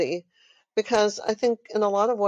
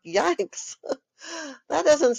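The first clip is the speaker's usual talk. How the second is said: high, laughing mock alarm; laughing yikes; 8 semitones higher, slower than usual. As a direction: laugh through a wry yikes. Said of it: "And I'm like".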